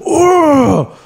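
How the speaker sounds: high, loud mock whine; shrill-mocking the furious wife; wife's tantrum, mocked high and loud; mocking wife's rant. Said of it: "she's like".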